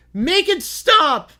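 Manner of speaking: slow, high, loud desperate shout. Acted. Crowd member shouting at the terrible float music. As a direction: yell from the crowd to end the noise. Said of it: "A lone voice shouted".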